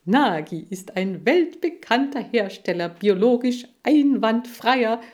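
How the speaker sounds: loud, showy pitchman bragging; boasting salesman. A narrator's reading. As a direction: boast about the brand to a TV audience. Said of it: "protzte Seifried".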